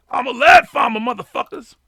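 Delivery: high, loud hammed-up rant; shrieking high mock declaration. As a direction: bellow the chat line like a crowd-rouser. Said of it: "does that work for your D W voice".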